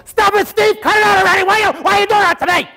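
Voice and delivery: high, loud shrieking protest, fast at the end; screaming victim. Retold or imitated. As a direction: shriek at him to stop. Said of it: "he's gonna go".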